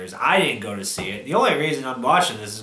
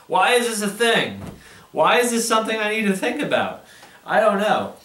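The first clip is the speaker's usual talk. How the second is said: frustrated ranting; 6 semitones higher than usual. Real, not acted. Angry rant about the movie.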